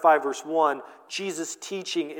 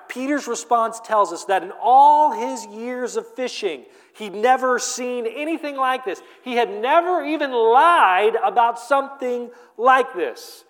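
higher, louder, marveling; excited storytelling; 6 semitones higher, 5 dB louder than usual. Real, not acted. Preacher marveling at Peter's miracle catch, excited. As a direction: sell the outlandish fish tale. Fish tale told with excitement.

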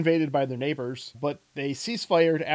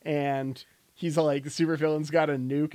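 ordinary talk, nothing marked

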